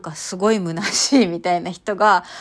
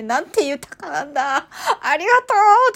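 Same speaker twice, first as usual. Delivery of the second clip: rising to a very high, loud thank-you; rapturous shout; 8 semitones higher, 6 dB louder than usual. Retold or imitated. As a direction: gush and then yell your thanks. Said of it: "とかって叫んでる時に". (感極まって叫ぶ)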